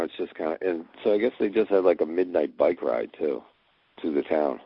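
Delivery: ordinary delivery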